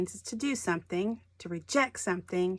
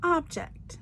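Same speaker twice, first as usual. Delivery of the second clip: OB-ject, high on OB then falling; stress on first syllable; 8 semitones higher than usual. Shown as a demonstration. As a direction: say Object with weight on OB. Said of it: "The emphasis on the first part of the word".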